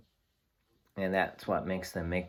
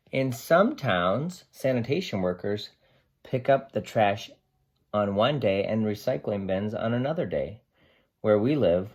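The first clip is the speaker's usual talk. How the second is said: lively picture-book read-aloud; reading to children; 2 semitones higher, 6 dB louder than usual. Acted. Teacher reading Sam the dog's story to her class, lively and expressive.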